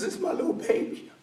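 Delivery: a father whispering through tears; breathless, weepy whisper over his little girl; choked crying whisper. Acted. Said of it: "he's just crying uncontrollably".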